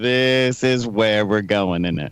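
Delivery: low and drawn-out, each word spelled out; slow, deliberate repeat. Real, not acted. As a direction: repeat it slowly so it gets through the headset. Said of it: "Say again".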